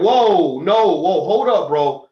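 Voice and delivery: loud, firm halt; retold warning. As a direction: re-enact men stepping in to stop someone. Men step in against threat.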